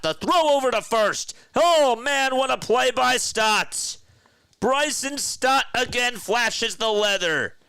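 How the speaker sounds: thrilled home-team announcer; excited call. Real, not acted. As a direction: yell it as the fielder makes the play. Excited call of great play.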